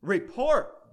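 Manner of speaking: high, loud, explosive bark; barked command. A narrator's reading. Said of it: "barked the prince".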